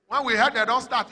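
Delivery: ordinary talk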